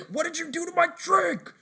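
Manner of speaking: hammed-up outrage at a drink; angry customer. Retelling a SpongeBob bit, an outraged customer. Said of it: "the guy gets so angry".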